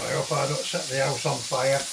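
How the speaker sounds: usual speaking voice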